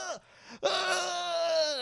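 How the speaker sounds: high, drawn-out cry of pain; acted pained groan. Doll loses an arm, high pained wail. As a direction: groan as if just shot.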